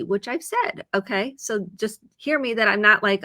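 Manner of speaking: normal talking voice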